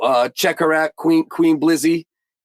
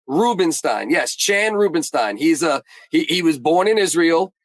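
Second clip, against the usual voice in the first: ordinary delivery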